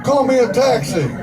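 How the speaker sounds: high mock call for a taxi; guy hailing a cab, mock shout. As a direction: hail it like a customer. Customer calling out.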